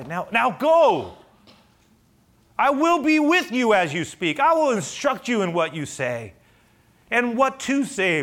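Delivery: loud, high, booming orders; commanding God. Acted. The Lord sending Moses out to speak, commanding. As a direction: send him off with forceful authority. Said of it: "The Lord asked Moses".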